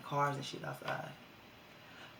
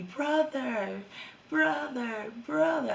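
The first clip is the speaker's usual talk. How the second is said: high, squeaky mock girl calling brother; mocking girly brother; 9 semitones higher, 5 dB louder, much slower than usual. Mocking girls who call boys brother, squeaky and high. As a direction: mimic the girls cooing brother, rising higher. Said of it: "I hate when hoes be".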